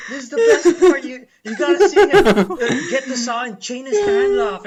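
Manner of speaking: high, loud, laughing excitement; hammed-up thrilled movie fan; gleeful urging. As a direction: urge her to keep watching, cracking up. Acts out gleeful plea to crying ex.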